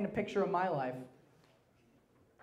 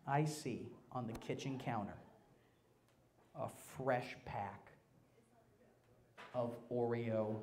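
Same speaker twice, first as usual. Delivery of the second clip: hushed; 7 semitones lower, 12 dB quieter than usual. Acted. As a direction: draw them in quietly. Hushed build-up to the cookies.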